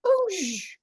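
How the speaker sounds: a quick, high whooshing "Oosh!" for the chicken shooting off; chicken whoosh noise. Retold or imitated. Telling a friend how the chicken shot off, mock whoosh. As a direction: mimic the chicken launching away. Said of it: "She just went".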